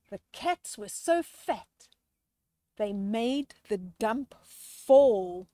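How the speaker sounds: lively rhyming storybook read-aloud; reading to children. A narrator's reading. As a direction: read the rhyme to the kids with relish. Rhyming picture book read aloud.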